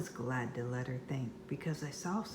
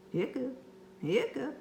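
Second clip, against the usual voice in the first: hiccups; 8 semitones higher than usual. Acted. Monster hiccups.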